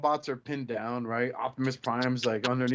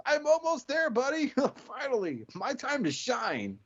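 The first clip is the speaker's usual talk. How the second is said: much higher, eager cartoon voice; squeaky eager robot; 12 semitones higher than usual. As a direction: chirp it like a sidekick finally getting his moment. Hot Hose voiced in a fan story.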